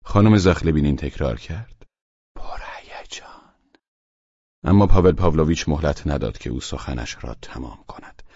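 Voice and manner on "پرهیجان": a soft whispered repeat of the word; whispered echo; hushed echo. Acted. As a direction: breathe the word back quietly. Lady echoing a word.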